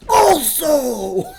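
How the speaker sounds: high, loud hyped-up yell; excited shout. Real, not acted. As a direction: blurt it out wired on caffeine.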